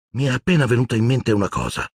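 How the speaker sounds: choked voice. A narrator's reading. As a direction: blurt out the idea with a catch. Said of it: "disse Ron con voce soffocata". (voce appena soffocata)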